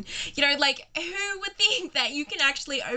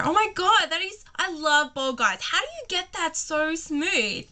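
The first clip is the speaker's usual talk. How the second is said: gushing; 6 semitones higher, slower than usual. Retold or imitated. Narrator retelling her flirty line to the bald guy, gushing. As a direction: fawn over him like a starstruck fan. Rubbing bald guy's head.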